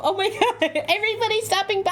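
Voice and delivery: laughing high and fast; giggling through the words. Real, not acted. Viewers trigger stream effects.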